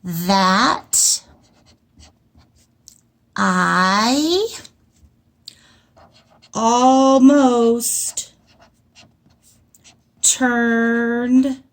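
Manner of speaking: each word alone with long gaps; word by word dictation. Shown as a demonstration. Teacher sounds out words while writing for the class. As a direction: say one word, pause, write it. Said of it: "teacher writes sentence".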